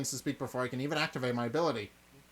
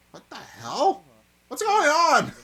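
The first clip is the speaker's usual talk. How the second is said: much higher, louder startled cry; shocked yell; 12 semitones higher, 11 dB louder, much slower than usual. Real, not acted. Player startled by a surprise character, yelling.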